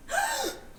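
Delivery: shocked high gasp; sharp gasp. Acted. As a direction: gasp in horror at a stain. Horrified at stain on tiles.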